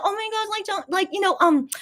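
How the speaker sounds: high, breathless gushing fan voice; gushing fangirl. Retold or imitated. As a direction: gush like a starstruck fan. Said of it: "shout out something that's, like, a personal thing".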